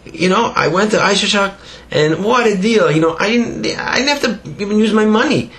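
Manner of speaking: high, excited boasting voice; proud simple man. Retold or imitated. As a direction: brag like a simple villager.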